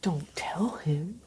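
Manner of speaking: hushed, drawn-out whisper; urgent whisper. Pretend. Co-host pleads with the daughter in the story, whispering. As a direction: whisper it slowly like a secret.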